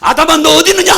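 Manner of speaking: loud, high calling voice; God calling Adam loudly. Acted. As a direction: call out to the hiding man. God's call repeated.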